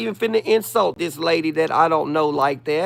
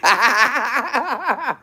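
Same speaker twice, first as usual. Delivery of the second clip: laughing; 5 semitones higher than usual. Real, not acted. Cracks up after the bit.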